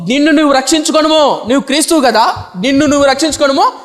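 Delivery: high, declaimed taunt; imitated scoffing, pitched well up; reviling. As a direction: act out the thief's jeering challenge. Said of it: "నేను దూషిస్తూ".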